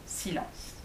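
usual speaking voice

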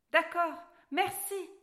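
thrilled to go home; happy girl thanks the dwarf; delighted. A narrator's reading. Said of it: "répondit Alice ravie".

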